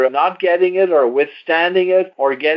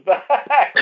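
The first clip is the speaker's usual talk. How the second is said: word breaks into a real laugh; 7 semitones higher than usual. Real, not acted. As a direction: laugh right through the last word. Host laughs telling doctor joke.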